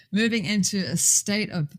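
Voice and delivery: usual speaking voice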